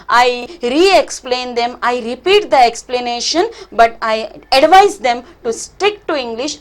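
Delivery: normal talking voice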